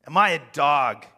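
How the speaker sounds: a touch of Goliath's bellow; roaring giant. A narrator's reading. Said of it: "he roared at David".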